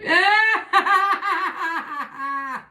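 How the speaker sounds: high, long rattling laugh; long staccato laugh, trailing down; loud cackle. Real, not acted. Bursts out laughing at herself.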